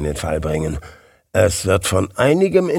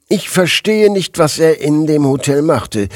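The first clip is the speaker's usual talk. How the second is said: agitated bewilderment; 6 semitones higher, 4 dB louder than usual. A narrator's reading. Colleague agitated at news of murder.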